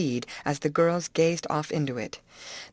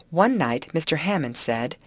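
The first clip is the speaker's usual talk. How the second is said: ordinary delivery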